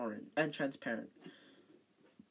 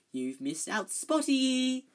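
hissed taunt; 9 semitones higher, 6 dB louder, slower than usual. A narrator's reading. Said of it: "Peeves hissed in her ear".

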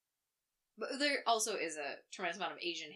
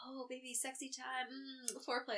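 mock seductive murmur; 4 semitones higher, 8 dB quieter, slower than usual. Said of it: "you're like".

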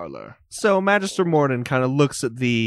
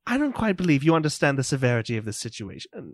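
fast, clipped-off lecture delivered in one breath; brisk scolding magistrate; 3 semitones higher, faster than usual. Acted. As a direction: rattle off a scolding. Morden scolding.

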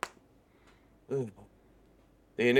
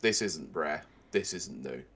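ordinary delivery

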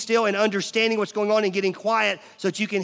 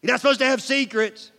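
put-on higher, louder child scolding her parents; indignant daughter; 7 semitones higher, 5 dB louder than usual. Daughter scolding her parents for whispering, indignant. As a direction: tattle like an offended kid. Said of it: "And she's like".

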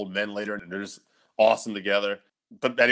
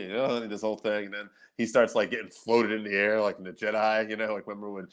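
normal talking voice

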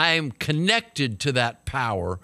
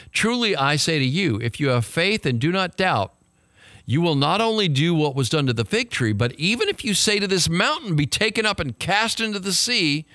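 fervent preaching; 5 semitones higher than usual. Acted. Pastor to church, quoting Jesus on faith, fervent. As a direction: proclaim it like a charge to the crowd.

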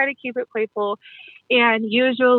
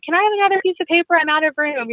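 higher, fast, mock-eager plea; mock eager guest; 7 semitones higher than usual. A party guest begging the host for more paper, mock eager. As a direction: play an overexcited guest who has run out of room.

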